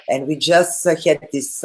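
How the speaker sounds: normal talking voice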